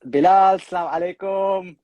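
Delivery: excited shout. Real, not acted. Excited greeting of joining guest.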